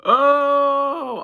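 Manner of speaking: high, loud fake wail, then a slow teary quote; a hammed-up sob over the threat; mock crying. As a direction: ham up a crying fit.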